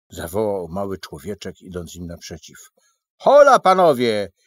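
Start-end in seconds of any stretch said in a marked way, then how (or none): 3.2-4.3 loud, much higher hail; hailing the travellers loudly; calling out. Acted. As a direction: call them over from a distance. Little man calls out greeting.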